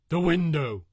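high, full scream of horror; terrified scream. A narrator's reading. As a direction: scream as it breaks through the window.

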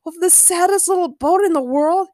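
high, put-on mocking voice; scornful storybook jeer, no real laugh; mocking amusement. A narrator's reading. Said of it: "Ray laughed".

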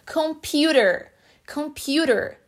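"computer" slowly, T tapped like R; American flapped T. Shown as a demonstration. Teacher giving another example, American flapped T. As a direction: stretch "computer" with an American tap and R ending. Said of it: "lá nos Estados Unidos, no inglês americano".

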